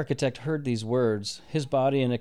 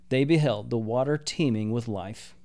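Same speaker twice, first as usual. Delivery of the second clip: ordinary delivery, nothing marked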